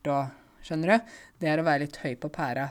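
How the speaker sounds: usual speaking voice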